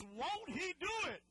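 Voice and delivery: high, loud, slow preacher cry; loud, slow cry of conviction; full conviction. Shown as a demonstration. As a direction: shout it like you mean it. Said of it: "say it like you mean".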